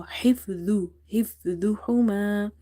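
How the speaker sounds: حفظهما with a heavy "dh", tongue tip at the teeth; hif-dhu-huma, a thick "dh" without buzzing z; heavy dha. Shown as a demonstration. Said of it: "dha is heavy as well".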